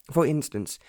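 plain reading voice

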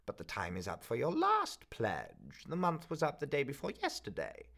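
a miserly crone laying down the rules; the pawnbroker telling the student his pledge has lapsed, coldly; stern old pawnbroker. Acted. Old woman refuses, far voice.

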